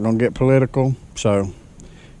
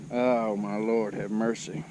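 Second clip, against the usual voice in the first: resigned sigh; 2 semitones lower, 10 dB quieter, slower than usual. Real, not acted. Deflated after forgetting paddle.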